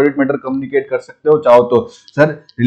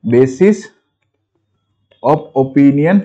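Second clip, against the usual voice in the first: slow dictation; much slower than usual. Shown as a demonstration. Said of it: "तो इसको ऐसा लिखते हैं".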